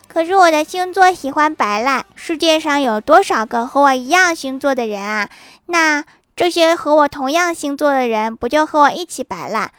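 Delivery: acted aggrieved protest. Child excusing slacking to a scolding elder, acted aggrieved. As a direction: plead your case, playing hard-done-by. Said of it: "郭晓霞委屈地说".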